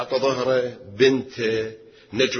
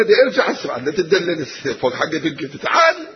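urgent calling back; 5 semitones higher than usual. Acted. Father urging him to return.

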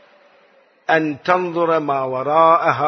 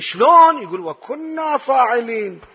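doubter's objection; 9 semitones higher than usual. Retold or imitated. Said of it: "ممكن واحد يستشكل".

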